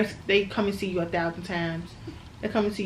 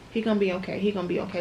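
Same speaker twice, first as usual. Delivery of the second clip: usual speaking voice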